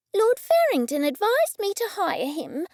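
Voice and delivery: firm and high, brooking no argument. A narrator's reading. Cressida defending her new chef to the narrator, firm and final. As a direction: shut the debate down. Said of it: "a tone that showed she would brook no argument".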